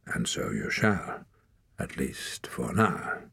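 low, slow, ominous ruling; grave verdict. A narrator's reading. Leader lets the boy live for now, grave. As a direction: hand down the sentence slowly.

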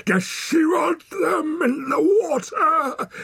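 slow, high, shouted taunt; angry cry. A narrator's reading. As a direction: cry out the threat at high pitch. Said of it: "he cried".

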